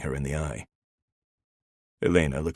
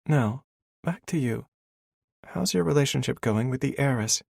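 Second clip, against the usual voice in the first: put-on higher, teasing young woman; female character voice; 10 semitones higher than usual. A narrator's reading. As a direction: tease him as the heroine. Elena asking.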